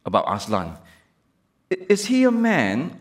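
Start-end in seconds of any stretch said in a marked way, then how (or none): 1.7-3.0 a lightly voiced little girl, higher; Lucy asking. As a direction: ask like a curious child. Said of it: "asked Lucy".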